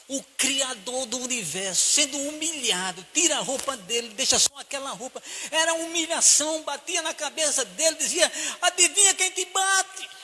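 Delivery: high, loud impassioned preaching; preaching at full force. Real, not acted. Preacher stirring the congregation, loud and high. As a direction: build the fervour of the crucifixion story. Preacher rising over Christ's humiliation.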